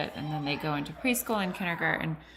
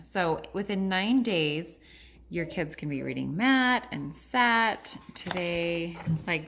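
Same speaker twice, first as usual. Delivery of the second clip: ordinary talk, nothing marked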